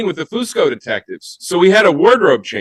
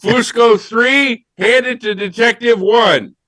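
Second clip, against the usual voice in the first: raised, deliberate director's voice; higher-pitched mock-official commands to the cast; director calling orders; 6 semitones higher than usual. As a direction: call out each instruction like a film director. Acts out director ordering tie swaps.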